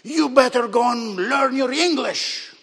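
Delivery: loud, high imitation of an upset woman. Upset client scolding the man who helped her, angry mimicry. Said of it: "she got so upset with me".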